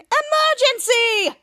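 high, drawn-out alarm call; group cry. Retold or imitated. Team shouting in unison, high drawn-out cry. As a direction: call it out high, stretching each syllable. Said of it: "Danger Force and Captain said in unison".

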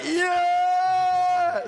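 high, drawn-out whooping yeah; mock American fan's cheer; loud fan cheer. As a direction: cheer like a rowdy crowd. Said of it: "you're really loud about supporting us".

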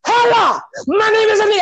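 high, loud triumphant cry; mock climax shout. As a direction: hit it at full force. Inigo's line at its peak.

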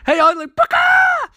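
very high, loud charging yell; battle yell. Retold or imitated. Barry charging Eiling, a very high battle yell. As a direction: scream it as you smash him. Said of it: "Barry's like".

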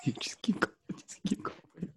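urgent whisper. Real, not acted. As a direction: whisper so the caller can't hear. Whispering to friend during call.

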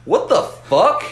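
high, loud shocked outburst. Retold or imitated. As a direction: blurt it out in disbelief. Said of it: "And Sam goes".

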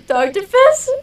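high, wailing "Doctor Pepper"; laughing cry. Pretend. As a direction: wail it through laughter. Mocking the order, crying laugh.

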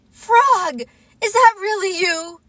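high, loud, quick question; astonished relief. Acted. Waking Toad finding Frog at bedside, high astonished question. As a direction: ask as if hardly believing it.

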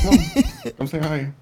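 high-pitched call to a pet; coaxing a dog. Real, not acted. Streamer calling his dog to say hi, high coaxing voice. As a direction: coax the dog over in a lifted voice.

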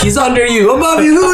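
panto crowd callback. Pretend.

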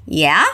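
"Yeah?" sliding up at the end; a rising "Yeah?" to confirm and keep things going; questioning yeah. Shown as a demonstration. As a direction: repeat "Yeah?" with an upward question tune.

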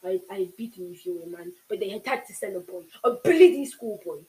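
loud, raw howl of rage; villain howls at the boy who ruined his plan. A narrator's reading. Howl it out in fury. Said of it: "Cyril howled".

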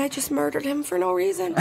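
laughing at her own guilt; mock horrified. Realizing the killing was pointless, high, soft and guilty. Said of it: "I said".

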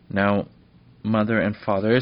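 firm, loud address to her parents. A narrator's reading. Princess firmly tells her parents her plan. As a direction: say it firmly, no arguing. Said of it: "said Fenella firmly".

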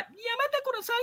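mock high voice. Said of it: "Gustavo decía".

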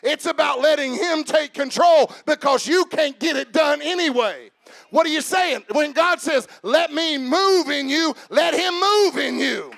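loud, high, shouted sermon climax; preaching at full force. Acted. Preacher exhorting the congregation, shouting with fervor. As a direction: preach it hard, rising to a shout.

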